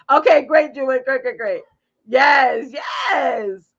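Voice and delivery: loud, drawn-out cheering agreement; emphatic yes. Real, not acted. Host agreeing with viewer, excited. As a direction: belt out the yes.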